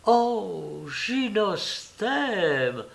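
high, very drawn-out rapture; enthusiastic exclamation. A narrator's reading. Hostess enraptured by the savant's new word, enthusiastic. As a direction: swoon slowly over the learned word. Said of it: "exclama madame Jujube avec enthousiasme".

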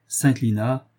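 ordinary delivery, nothing marked

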